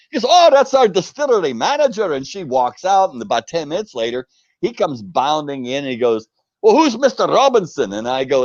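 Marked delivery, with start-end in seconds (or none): 0.3-2.1 surprised lady. Retold or imitated. Lady answers visitor's question. 6.6-7.9 higher, louder Scottish voice asking for Robinson; Scottish manager. Retold or imitated. Distillery manager greeting the American visitor in Scottish voice.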